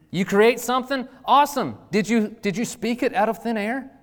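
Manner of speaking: preaching. Acted. Pastor drives the point home to the church, preaching hard. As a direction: preach it out to the congregation with fire.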